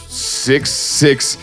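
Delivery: usual speaking voice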